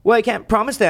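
the high take of "Well, I can't promise that"; mocking partner's refusal. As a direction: imitate her whiny refusal. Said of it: "And she's like".

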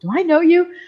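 put-on high, questioning voice of her old teacher; puzzled old teacher. Teacher not recognizing his former student, puzzled. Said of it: "he goes".

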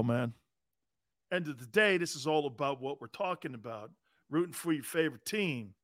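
ordinary talk, nothing marked

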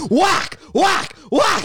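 high loud whack sounds; imitated whacks. Brooms beating Gina, mock whack sounds. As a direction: smack out each whack.

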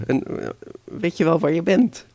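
high-pitched incredulous question; incredulous grandmother. Acted. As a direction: question the clueless visitor. (ongelovige grootmoeder, hoge stem)

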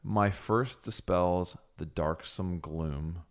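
very slow, each word set apart; slow dictation. Shown as a demonstration. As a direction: read it out like a dictation. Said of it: "I will read it again".

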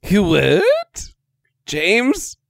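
baffled. Real, not acted. Player baffled by new co-host.

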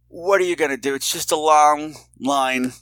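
ordinary delivery

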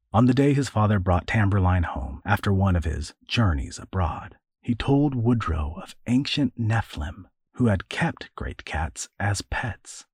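normal talking voice

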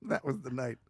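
ordinary talk

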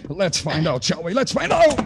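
strained, pushed out mid-struggle; forced out while grappling; straining in a fight. Acted. Detective strains against the yogi's hold.

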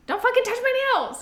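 very high, loud shriek; shrill reaction. Retold or imitated. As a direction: shriek it at him. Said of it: "a reaction".

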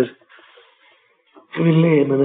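very slow, stretched longing on וויל; aching prayerful cry to live; drawn-out plea. Acted. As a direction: hold וויל as if the whole heart hangs on it. Climax of the pleading prayer.

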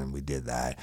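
usual speaking voice

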